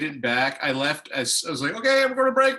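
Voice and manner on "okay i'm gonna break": fast high auctioneer call. Retold or imitated. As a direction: rattle it off like an auctioneer. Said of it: "I sound like an auctioneer".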